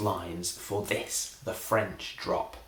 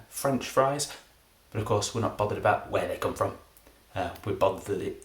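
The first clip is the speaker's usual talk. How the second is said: ordinary talk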